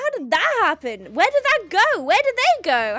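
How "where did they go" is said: usual speaking voice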